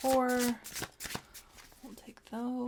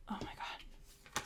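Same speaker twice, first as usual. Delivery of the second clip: whispered surprise; 11 dB quieter, slower than usual. Real, not acted. Reacting to card.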